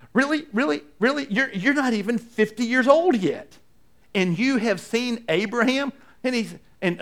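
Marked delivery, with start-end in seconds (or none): 0.1-5.9 much higher, scoffing disbelief; mock incredulous crowd. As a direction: sneer at the claim like a doubting crowd. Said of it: "they look at their response like".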